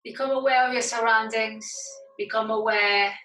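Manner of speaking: measured relaxation-class voice, leaving space to breathe; guided meditation. Acted. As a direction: guide them inward, phrase by phrase, unhurried. Leads yoga meditation.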